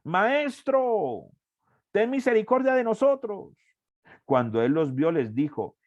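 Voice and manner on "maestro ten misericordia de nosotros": high, long-held calling cry; raised voices. Retold or imitated. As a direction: imitate lepers calling from a distance. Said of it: "alzaron la voz diciendo".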